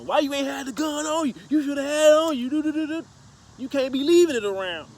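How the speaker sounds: high-pitched imitation of scolding relatives; high, loud mock scolding; mock nagging. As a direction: mimic family giving you flack, voice pushed way up. Retold scolding from brother and nephews.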